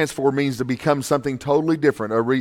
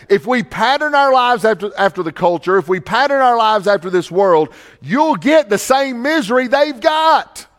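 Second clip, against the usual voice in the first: raised, urgent pulpit voice; preaching at full force; 7 semitones higher, 5 dB louder than usual. Real, not acted. Pastor warning the church about the culture, preaching hard. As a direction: drive the warning home to the congregation.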